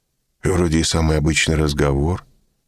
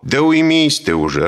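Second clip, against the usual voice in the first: shouting; 9 semitones higher, 4 dB louder than usual. A narrator's reading. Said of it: "раскричался на весь подъезд".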